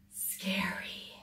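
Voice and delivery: whispered scary; spooky whisper. A narrator's reading. Reader spooking young listeners about the fish, spooky whisper. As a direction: breathe the word scary out in a whisper.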